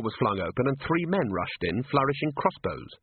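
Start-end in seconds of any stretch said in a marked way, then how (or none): none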